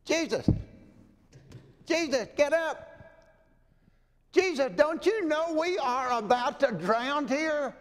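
high, urgent panicked cry; frightened plea. Acted. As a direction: wake him, terrified of drowning. Disciples wake Jesus in storm.

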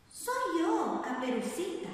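wolf pretends to be the girl at the door; wolf faking a girl's voice. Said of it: "fingiendo voz de niña".

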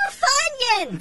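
very high delighted squeak; excited squeal. A narrator's reading. Robot spotting Jesse's Funyuns, high excited squeal. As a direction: spot the snack and squeal.

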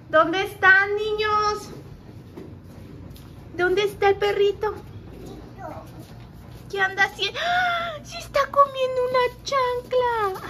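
high, bright calling voice. Real, not acted. Looking for dog and toddler.

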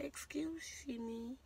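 soft breathy whisper of "Excuse me"; hushed whispered excuse me; whispered quote. Retold or imitated. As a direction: whisper it as if mimicking overheard words. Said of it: "We heard her say".

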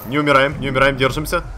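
ordinary talk

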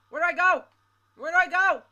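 high, loud panicked question; terrified gibbering. Real, not acted. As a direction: beg for a way out, frantic. Fleeing monster, panicked.

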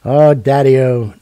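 slow, loud and affectionate; drawn-out loving sigh to Dad; fond, drawn-out call to Daddy-O; fond exclamation. Real, not acted. Fond exclamation about his father.

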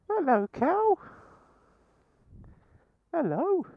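high rising sing-song greeting; high cooing hello to the cow. Acted. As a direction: coo it up high like to a pet.

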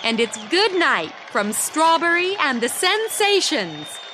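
high, loud stage announcement; shouted into the microphone. Acted. Singer shouting goodnight to the crowd into the mic. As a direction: call it out to the whole hall. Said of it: "shouted Cindy into the microphone".

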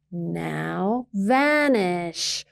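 very slow, drawn-out command; slow grumpy note to the neighbors; grumpy written order. Acted. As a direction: stretch each word out sternly. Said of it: "Swashby wrote".

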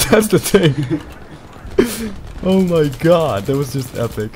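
talking through real laughter; laughing. Real, not acted. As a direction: laugh while getting the words out. Laughing at the wild play.

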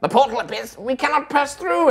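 squeaky-high, alarmed little fairy; high-pitched tiny warrior. Acted. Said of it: "kind of high-pitched voice".

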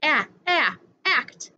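short "ah, ah" then the whole word "act"; first sound isolated. Shown as a demonstration. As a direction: repeat the opening a of "act" separately, then say it. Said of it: "start with the ah sound".

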